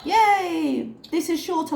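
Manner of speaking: high, mock-excited yay; sarcastic cheer. Mocking Chantel's new health journey, sarcastic high cheer.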